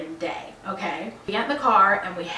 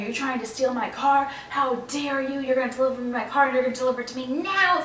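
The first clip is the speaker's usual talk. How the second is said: indignant, higher mock outburst, speeding up; retold angry fit; 4 semitones higher than usual. Customer throwing a fit at the delivery man, indignant. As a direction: act out freaking out, rushing into demands. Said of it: "throwing a whole fit".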